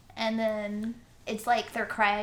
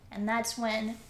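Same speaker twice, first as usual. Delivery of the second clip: ordinary delivery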